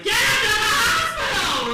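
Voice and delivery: high, very loud mock-mother scream; panicked, full-volume order; mothers yelling. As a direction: shriek it like an outraged mother. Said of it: "Mothers are like".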